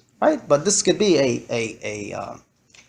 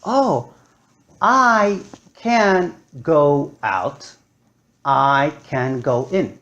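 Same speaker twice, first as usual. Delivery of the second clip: drawn out slowly, discovering; child realizes she can choose, slowly; slow realization; 2 semitones lower, much slower than usual. Retold or imitated. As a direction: savour each word as a new idea.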